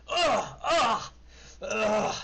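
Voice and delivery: high loud grunts, one per hit; pained grunts. Acted. Halfling hit by crossbow bolts grunts behind the wall, high. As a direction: groan through each bolt. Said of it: "and you hear".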